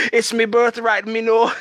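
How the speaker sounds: a bad Jamaican-accented villain, insistent; Bushmaster accent. Fan quoting the villain correcting Mariah's name in a hammed-up Jamaican accent. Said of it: "Bushmaster would always say".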